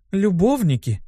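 high, teasing prompt; supply the word mockingly. Succubus finishing the dean's sentence, teasing. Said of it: "насмешливо подсказала нужное слово Лерра".

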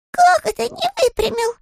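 high surprised bear voice; puzzled small bear, high. Acted. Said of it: "удивился Паддингтон".

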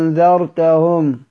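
أنذرتهم slowly, second hamza melted toward alif; eased hamza demo. Shown as a demonstration. Teacher models the whole word, eased hamza in أنذرتهم. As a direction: glide through the second hamza of أنذرتهم without a full stop. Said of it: "أجعلها بين الهمزة والألف".

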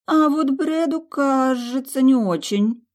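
high, slow dawning realization; as if it just hit her; sudden realization. A narrator's reading. Susie realizing Brad is unlucky, high surprised. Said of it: "озарила вдруг её".

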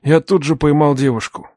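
plain reading voice

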